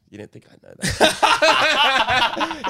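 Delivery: high, slow, laughing mock of the smug cop. Mocking the cocky constable to a friend, laughing. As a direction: laugh through a hammed-up smug cop line.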